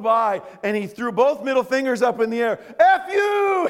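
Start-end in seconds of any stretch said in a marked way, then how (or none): 2.8-3.7 much higher and louder, grinning; cheerful F you. Retold or imitated. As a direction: shout it with a big smile. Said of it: "he said to me".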